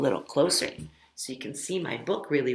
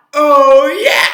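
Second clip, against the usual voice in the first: loud, much higher whooping oh yeah; 10 semitones higher, 19 dB louder than usual. Shown as a demonstration. Teacher whoops the chant's oh yeah at the class, loud and high. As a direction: whoop it out big. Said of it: "It's very important to be kind".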